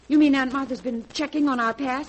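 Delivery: shaken, realising they're exposed; alarmed outburst. Acted. Wife learning the aunt investigated them, alarmed outburst.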